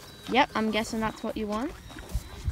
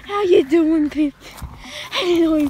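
higher, louder cooing hello to a horse; pet voice; 5 semitones higher, 5 dB louder than usual. Real, not acted. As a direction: coo at the pony like a pet.

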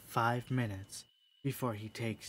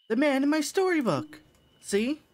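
put-on very high, loud little kid; high-pitched eager child; tot voice; 8 dB louder than usual. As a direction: insist like a small child showing mom. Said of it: "good voice for this tot".

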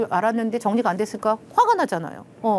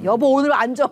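mock husband; 3 semitones higher, 4 dB louder than usual. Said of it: "이렇게 하면 되게 놀래겠죠".

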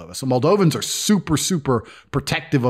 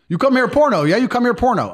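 higher, jeering Eastern European broken English; mock Moldovan accent; 9 semitones higher than usual. Moldovan man provoking the Americans before the fight, mock accent. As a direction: needle the tourists in a thick put-on accent. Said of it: "He goes".

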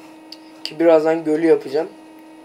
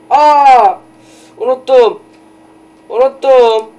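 much higher and louder alarmed cry; shocked realization; 8 semitones higher, 12 dB louder, much slower than usual. Real, not acted. Realizing he forgot something, shocked loud cry to viewers. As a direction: gasp as it hits you that you forgot. Suddenly remembers forgotten thing.

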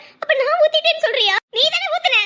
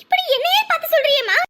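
wounded protest; 4 semitones higher than usual. Acted. Stepmother acts hurt, wronged.

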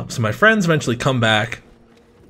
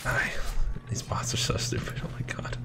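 whisper; 12 dB quieter than usual. Real, not acted. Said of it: "whispered aside".

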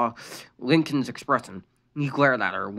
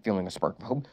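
usual speaking voice

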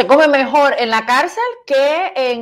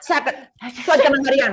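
riendo; 3 semitones higher than usual. Real, not acted. Broma entre ambas.

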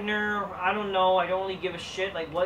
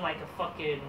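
ordinary talk

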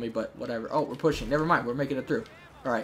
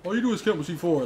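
higher mocking whine; complaining player; 4 semitones higher than usual. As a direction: mimic a complaining opponent. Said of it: "people will call me a camper".

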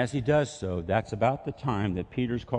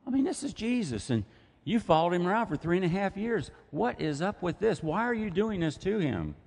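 scolding; 9 semitones higher than usual. Retold or imitated. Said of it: "And we say".

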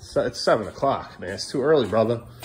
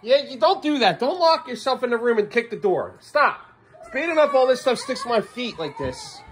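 higher-pitched, fed-up telling-off; exasperated scolding; 6 semitones higher than usual. Real, not acted. Parent tells child off about mess underfoot, exasperated. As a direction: plead and scold in real frustration.

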